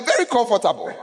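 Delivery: normal talking voice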